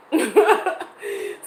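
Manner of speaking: rising high giggle; a real giggle; high laugh. Real, not acted. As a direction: laugh up high, a bit embarrassed. Laughing at silent audience.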